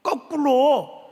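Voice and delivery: loud, high, slow 거꾸로; emphatic. Real, not acted. Preacher stressing the twist to the congregation, emphatic. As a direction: stretch and punch 거꾸로. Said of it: "거꾸로 말한".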